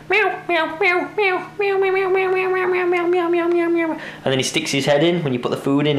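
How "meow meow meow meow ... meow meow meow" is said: very high, insistent meowing; imitating a cat. Owner shows how the hungry cat begs, mimicking high meows. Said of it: "he's like".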